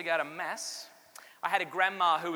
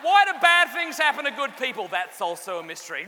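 loud, higher mock outrage; exasperated retort; 5 semitones higher, 9 dB louder than usual. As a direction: throw it back at her, fed up. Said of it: "I'm like".

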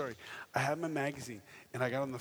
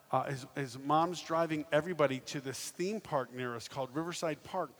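usual speaking voice

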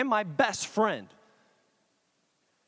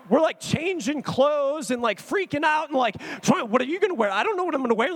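high, flustered excitement; freaking out; 6 semitones higher, 3 dB louder than usual. Retold or imitated. Friends changing clothes before meeting band, freaking out. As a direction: re-enact a fan's giddy panic. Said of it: "freaking out like a fifteen-year-old girl".

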